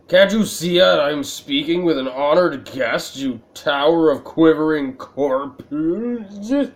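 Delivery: coffee vendor snapping at the player, loud and indignant; vendor shouts. Acted. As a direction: berate the impatient customer loudly. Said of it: "The coffee vendor shouts".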